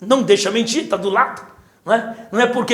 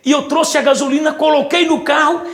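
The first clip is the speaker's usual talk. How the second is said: ordinary talk, nothing marked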